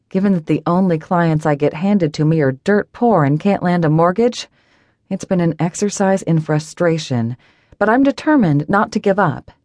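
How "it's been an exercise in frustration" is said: usual speaking voice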